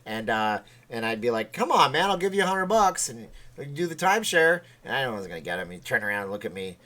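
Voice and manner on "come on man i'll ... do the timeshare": higher, louder re-enacted coaxing; acted-out hustler's pitch; pleading sales pitch. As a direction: coax a passer-by, putting on the salesman. Imitates his timeshare sales pitch.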